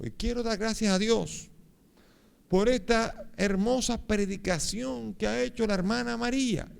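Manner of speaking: high, slow proclamation of thanks; ringing public thanksgiving, pitched high; exultant testimony. Retold or imitated. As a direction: imitate a man testifying to a crowd. Healed man testifying before crowd.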